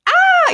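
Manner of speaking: short, high, loud panicked "ah"; hammed-up retold shriek; mock freak-out scream. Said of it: "like, freaking out".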